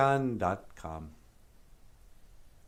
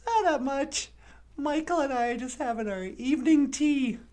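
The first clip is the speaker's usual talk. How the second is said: high puppet bonjour; 14 semitones higher than usual. Acted. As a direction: squeak it out like a bubbly puppet. Puppet character voice.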